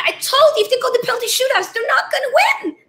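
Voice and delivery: high, fast half-laughing wail of dismay; anguished laugh-cry. Real, not acted. England losing the penalty shootout.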